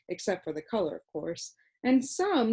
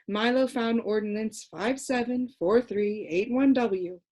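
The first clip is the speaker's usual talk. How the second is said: ordinary delivery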